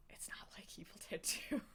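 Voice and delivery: conspiratorial whisper under the breath; whispered aside. Acted. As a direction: let it slip as a secret aside. Whispered aside after the hyped pitch.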